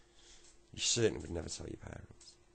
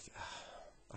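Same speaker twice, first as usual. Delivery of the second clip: soft breathy sigh; weary sigh; 10 dB quieter than usual. Acted. Tearful mum turns to Tommy, weary sigh. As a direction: let out a quiet, heavy sigh.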